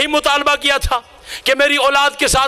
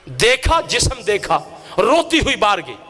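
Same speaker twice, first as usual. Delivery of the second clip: hushed grief; 6 semitones lower than usual. Acted. Preacher telling of the child's washing, low hushed grief. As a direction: drop low as the tragedy is revealed. Tragic climax of the washing story.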